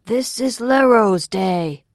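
loud, slow, resonant proclamation; high, drawn-out orator's call; ringing out. A narrator's reading. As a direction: proclaim it clearly to a distant crowd. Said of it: "his voice rang out, distant, but clear and resonant".

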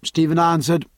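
ordinary delivery, nothing marked

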